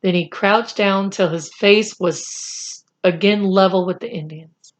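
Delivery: ordinary delivery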